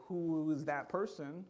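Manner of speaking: slow, searching questions; searching self-question. Retold or imitated. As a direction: ask the mirror slowly, seeking. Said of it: "look at yourself in the mirror and go".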